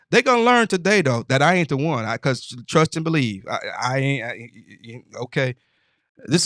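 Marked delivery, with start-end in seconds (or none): 0.1-2.0 defiant warning. Real, not acted.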